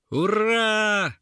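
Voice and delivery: high, drawn-out hurrah; cheering evil horde. A narrator's reading. Monsters applaud their victory, high gleeful cheer. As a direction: cheer long and high in triumph. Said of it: "зааплодировала нечисть".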